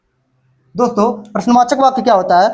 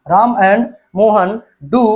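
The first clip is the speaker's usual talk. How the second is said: slow dictation; much slower than usual. Shown as a demonstration. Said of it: "slow dictation while writing".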